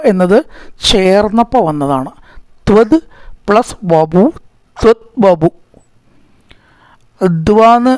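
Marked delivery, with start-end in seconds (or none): 2.7-5.5 ത്വത് and വപു said as separate words; split reading. Shown as a demonstration. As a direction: break ത്വത് from വപു clearly. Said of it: "പിരിച്ച് വായിക്കാം". (ത്വത് വപു പിരിച്ച് ചൊല്ലുക)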